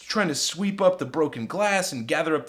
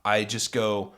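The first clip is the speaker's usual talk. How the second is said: usual speaking voice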